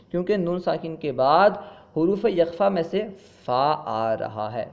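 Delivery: normal talking voice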